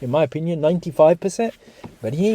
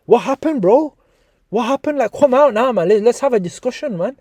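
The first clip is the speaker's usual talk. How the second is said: higher-pitched, challenging call-out; calling them out; 8 semitones higher than usual. Pretend. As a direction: goad them to show themselves. Calling out silent commenters to answer.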